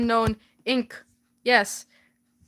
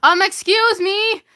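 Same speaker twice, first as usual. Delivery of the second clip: raised call over loud music; yelling over music; 6 semitones higher, 3 dB louder than usual. Retold or imitated. Shouting to a stranger across a loud party. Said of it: "I yelled through the deafening music".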